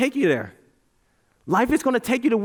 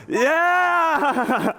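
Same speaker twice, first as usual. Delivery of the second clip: high, drawn-out cheer; long, elated high cry; triumphant cheer; 9 semitones higher than usual. Real, not acted. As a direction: celebrate with the crowd. Celebrates end of crowd chant.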